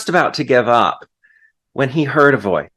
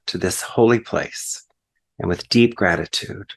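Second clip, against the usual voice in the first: prayer; 3 semitones lower than usual. Acted. Preacher leads the congregation into thanks, prayerful and hushed at the end. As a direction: settle gently into the amen.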